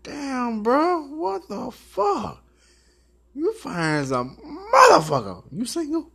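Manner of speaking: squealing mock pickup line; high excited flirt. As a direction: gush like you just saw a stunner. Imitated flirt on seeing man.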